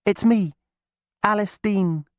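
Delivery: put-on young witch girl, sorry for her; Alice, sympathetic girl. A narrator's reading. Alice greets chained Maggie, high and sorry.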